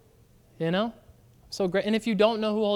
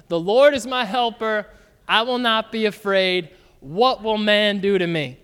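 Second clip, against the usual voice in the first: louder pulpit reading of the verse; louder, emphatic scripture proclamation; 4 dB louder than usual. Acted. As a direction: declare the promise to the congregation. Pastor reads scripture aloud.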